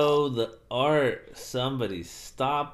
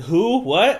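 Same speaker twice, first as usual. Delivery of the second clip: mock surprise; 8 semitones higher, 9 dB louder than usual. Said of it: "He's like".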